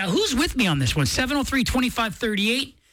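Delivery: ordinary talk, nothing marked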